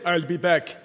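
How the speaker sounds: lightly put-on action-hero voice; Arnie. Preacher citing an Arnie promise, light Arnie impression. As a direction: slip briefly into the film star's accent. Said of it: "when Arnie says".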